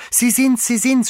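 put-on very high, loud, jubilant child; joyful scream. A narrator's reading. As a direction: cry out, jumping for joy. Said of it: "schrie Heidi und hüpfte hoch vor Freude". (jubelnder Freudenschrei)

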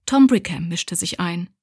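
ordinary delivery

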